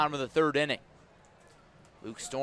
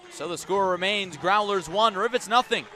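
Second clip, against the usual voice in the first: higher and louder, still riding the excitement; lively score recap; 5 semitones higher, 5 dB louder than usual. Real, not acted. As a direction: keep the energy up through the score. Recapping score after strikeout.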